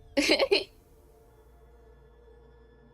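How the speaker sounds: big burst of laughter; loud laugh. Real, not acted. Streamer laughing at own shark joke, loud burst.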